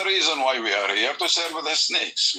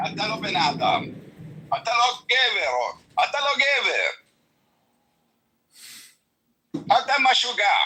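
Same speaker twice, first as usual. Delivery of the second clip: heated insults; 5 semitones higher, much faster than usual. Real, not acted.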